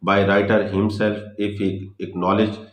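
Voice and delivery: very slow, word by word, as if dictating notes; slow heading read. Shown as a demonstration. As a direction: spell out the heading slowly so students can write it. Reading out a heading slowly. (धीरे धीरे शीर्षक लिखवाना)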